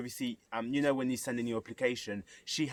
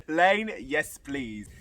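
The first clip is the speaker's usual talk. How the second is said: ordinary talk, nothing marked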